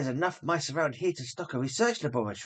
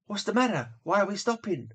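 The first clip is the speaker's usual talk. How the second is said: higher, sudden worry; alarmed question; 7 semitones higher than usual. Acted. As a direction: ask why the coach stopped. Lois alarmed in coach.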